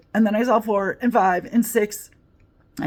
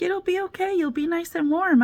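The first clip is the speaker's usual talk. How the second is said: much higher, sugary mock cop voice; imitating the cop; 11 semitones higher than usual. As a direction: lay on the sweet reassurance. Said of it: "the Czech police were like".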